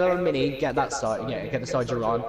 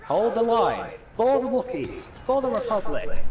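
rousing rally cry, pitched up; rally the troops, mock heroic; battle cry; 9 semitones higher, slower than usual.

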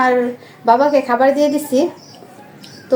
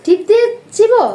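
playful mock surprise; 7 semitones higher, 5 dB louder than usual. Real, not acted. Mother echoing her child's answer, amused and high.

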